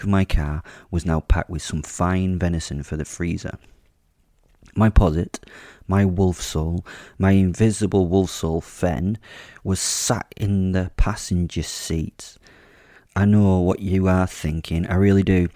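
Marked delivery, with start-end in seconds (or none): none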